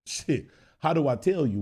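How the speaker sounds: ordinary talk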